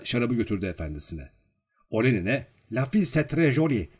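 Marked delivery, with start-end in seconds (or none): 2.7-3.9 higher-pitched French pronouncement; servant announcing. A narrator's reading. Servant declaring to his master in French, acted announcement. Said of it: "diye açıkladı".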